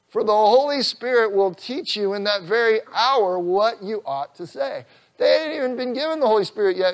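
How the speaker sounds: high, loud preacher's voice, slowed; fervent preaching. Acted. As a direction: preach it out with full force, rising. Preaching at full force.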